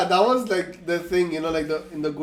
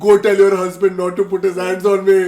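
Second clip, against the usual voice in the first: mock teenage rant; 10 dB louder, much faster than usual. Retelling teenage complaint to mom.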